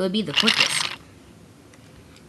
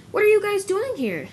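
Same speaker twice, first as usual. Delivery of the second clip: surprised; 12 semitones higher, 3 dB louder than usual. Acted. Scotsman surprised by crowded yard.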